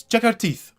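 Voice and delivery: high, loud order to the guards; commanding burgomaster. Acted.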